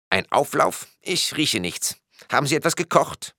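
high-pitched, questioning character voice; puzzled policeman. A narrator's reading. Policeman's voice in story.

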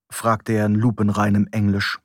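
ordinary delivery

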